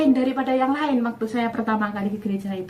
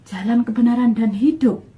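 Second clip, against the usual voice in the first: "jalan, kebenaran, dan hidup" said louder, "kebenaran" stressed, "hidup" rising; louder, eager repeat of the phrase; excited realization; 5 dB louder than usual. Retold or imitated. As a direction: proclaim it like a discovery. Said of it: "Berarti Tuhannya orang Kristen ini".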